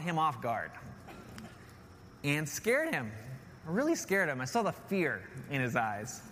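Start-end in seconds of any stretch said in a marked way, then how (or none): none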